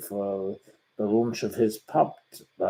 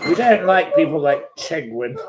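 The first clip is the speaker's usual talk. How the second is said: taunting dismissal; 6 semitones higher, 6 dB louder than usual. Real, not acted. Rejecting the troll.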